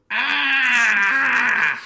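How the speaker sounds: put-on loud high toddler shriek; toddler scream. Dad imitating his son's refusing shriek. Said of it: "He just goes".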